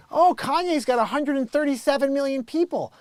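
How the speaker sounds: high, loud mock-impressed kid; mimicking his son. Son needling dad about [name]'s numbers, mock-impressed. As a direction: pitch it way up, gushing over someone famous. Mimics son's teasing boast.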